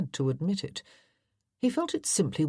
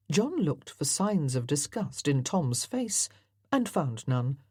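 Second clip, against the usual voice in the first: ordinary delivery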